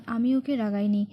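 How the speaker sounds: ordinary delivery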